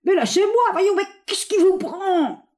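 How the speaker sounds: high, choked protest; half-strangled. A narrator's reading. Fontanet protests to the General choking him, half-strangled. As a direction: squeeze it out high, dragging the last word. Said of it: "à moitié étranglé".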